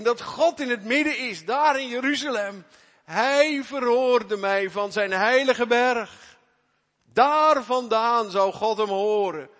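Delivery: loud, high, full-force sermon delivery; fervent preaching. Acted. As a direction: proclaim it with full force to the congregation.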